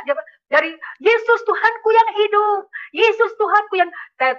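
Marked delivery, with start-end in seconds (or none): 1.0-3.9 imitated praise. Father suddenly confesses Jesus, imitated cry of praise. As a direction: draw out a high-pitched confession of faith. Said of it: "Papa saya begini".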